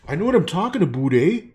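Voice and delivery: loud, fast hammed-up Canadian "aboot"; exaggerated Canadian accent. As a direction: belt it out like a hoser Canadian. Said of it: "I'm Canadian, eh?".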